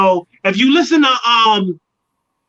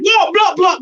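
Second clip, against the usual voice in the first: high, excited crowd noises; crowd going wild; 8 semitones higher than usual. Retold or imitated. Crowd reacting to his first line, excited. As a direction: imitate hyped hands-up shouts, pitched up.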